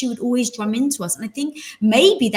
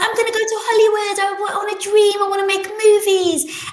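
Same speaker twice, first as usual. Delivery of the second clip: put-on eager kid; 11 semitones higher than usual. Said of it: "So I was like".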